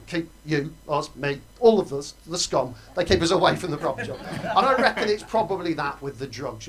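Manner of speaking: ordinary talk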